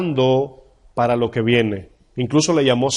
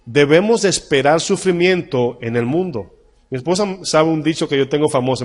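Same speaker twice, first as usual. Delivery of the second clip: normal talking voice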